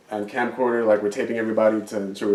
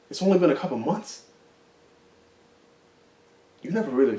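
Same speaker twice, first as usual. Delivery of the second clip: put-on higher-pitched, hurt and accusing ex-boyfriend; improvised breakup monologue; 5 semitones higher than usual. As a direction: plead and accuse an ex who moved on. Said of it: "giving, like, this random ass monologue".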